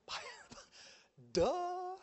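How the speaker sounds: high, laughing duh as if it were obvious; mock duh. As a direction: tease them with how obvious it is. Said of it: "mock obvious answer".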